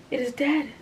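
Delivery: breathless. A narrator's reading. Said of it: "Frances said breathlessly".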